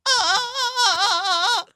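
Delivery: very high, loud repeated "uh" wails; hammed-up yelping cries; mimicked co-host cry. Shown as a demonstration. Guest copies the co-host's noise, mimicked co-host cry. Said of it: "Why do I sound just like you?".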